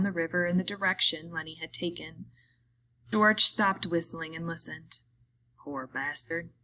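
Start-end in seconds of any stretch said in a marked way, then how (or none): 5.6-6.5 soft, low-key murmur to oneself; quiet private remark, dropped in level; said softly. A narrator's reading. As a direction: say it softly, under your breath. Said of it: "he said softly".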